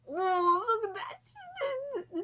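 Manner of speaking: high squeal, drawn out; excited squeal of delight. Real, not acted. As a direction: squeal at the picture. Excited at new panel.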